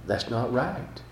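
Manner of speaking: a hint of a soft, hushed angel voice; lightly voiced angel pleading gently; gentle angel. Preacher voicing the shoulder angel warning the listener, softly. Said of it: "the angel on the other side would be going".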